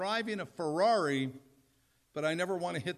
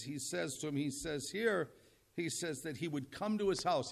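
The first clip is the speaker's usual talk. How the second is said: usual speaking voice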